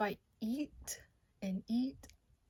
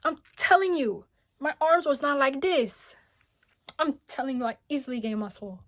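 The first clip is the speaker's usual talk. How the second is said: higher and louder, insistent bragging; excited boast; 5 semitones higher, 13 dB louder than usual. Real, not acted. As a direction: sell it, you can't believe it yourself. Bragging about muscles.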